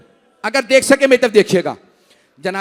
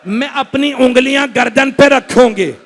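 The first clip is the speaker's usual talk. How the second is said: loud, rising majlis lament; preaching lament; 4 dB louder than usual. Acted. Preacher to the mourning gathering, loud lament. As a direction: cry out the mother's lament to the mourners.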